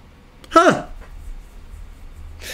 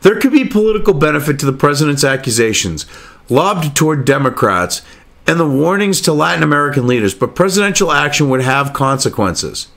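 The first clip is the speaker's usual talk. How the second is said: lower, measured reading-aloud voice; news article read; 9 semitones lower than usual. Acted. Reading the BBC piece aloud to viewers, measured read.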